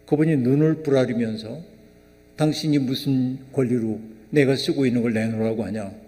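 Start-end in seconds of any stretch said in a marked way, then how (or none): none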